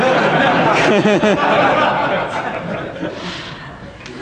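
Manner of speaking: high, open laugh; real amused laughter; hearty laugh. Real, not acted. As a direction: laugh at the absurdity. Laughs at student's story.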